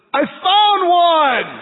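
loud, high, slow triumphant call; kid calling out. Retold or imitated. Little boy shouting to dad across the gym, loud and high.

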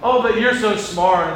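a lightly voiced fawning church member; fawning flatterer. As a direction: gush over him, a bit too sweet. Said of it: "And then I go".

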